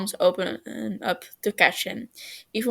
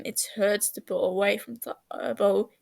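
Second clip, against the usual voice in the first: ordinary delivery, nothing marked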